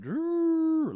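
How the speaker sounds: long rolled trill for police lights; imitated siren trill. Driver showing the cop lighting him up, trilled noise. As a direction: roll out a drawn-out siren noise.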